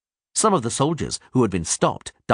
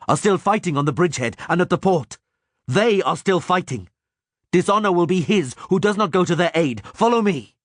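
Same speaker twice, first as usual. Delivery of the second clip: rallying yell; 10 semitones higher than usual. A narrator's reading. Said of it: "Kai yelled".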